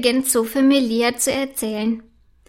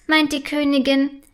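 ordinary delivery, nothing marked

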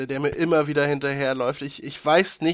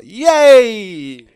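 high loud yay; loud cheer; 7 semitones higher, 12 dB louder than usual. Real, not acted. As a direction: cheer at the date. Cheer at release date.

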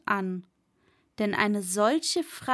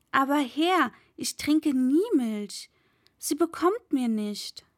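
pleading servant; 3 semitones higher than usual. Acted. Servant pleads with his master.

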